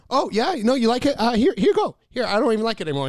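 high, loud, over-eager insistence; mock panicky generosity; imitating flustered girl. Acting girl anxiously giving away bracelet.

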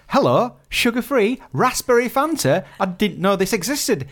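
excited. Retold or imitated. Delighted at substituted drink.

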